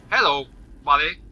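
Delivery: normal talking voice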